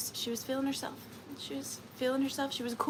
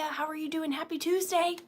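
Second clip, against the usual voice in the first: ordinary talk, nothing marked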